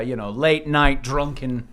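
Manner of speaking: ordinary delivery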